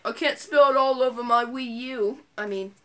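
ordinary delivery

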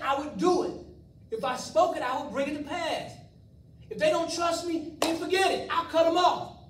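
put-on God fed up with faithless children, loud; angry God.

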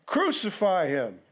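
mild crowd shout in a scripture reading; crowd crying out. A narrator's reading. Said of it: "they cried out again".

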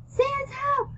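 very high-pitched call for help; yelled cry for help. A narrator's reading. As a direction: yell for rescue, voice shot way up. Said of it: "She yelled, I yelled".

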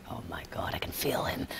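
hushed, breathless whisper to oneself; secret, voiceless gasp of awe; awed whisper. Retold or imitated. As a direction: whisper it in amazement. Awed aside in retold story.

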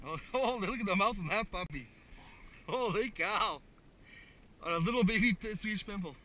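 high, loud, gleeful laughter; giddy with delight at a huge fish; excited laughing. Real, not acted. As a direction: marvel out loud at the catch. Marvelling at landed fish.

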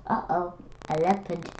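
ordinary delivery, nothing marked

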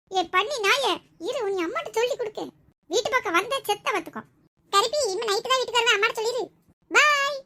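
high-pitched squabbling shouts; yelling. Acted. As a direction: trade heated sibling threats at the top of your voice. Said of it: "ஏன் இந்த அலற அலற?". (உரத்த குரலில் அலறிச் சண்டை)

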